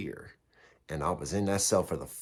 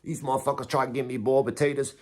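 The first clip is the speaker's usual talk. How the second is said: higher pitched, cocky angry rant; replaying his fed-up outburst at the lieutenant, higher and heated; retold attitude; 6 semitones higher than usual. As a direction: vent it like a fed-up young inmate. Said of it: "I already got my attitude".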